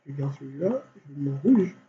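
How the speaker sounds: usual speaking voice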